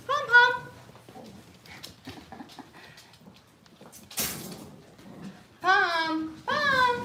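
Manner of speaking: high, loud call to the dog. Real, not acted. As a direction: call her over brightly. Calling the dog excitedly.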